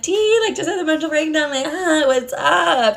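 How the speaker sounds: high, sing-song fake breeziness; mock awkward cheer. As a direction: play it coy after a meltdown. Awkward cover-up after her breakdown.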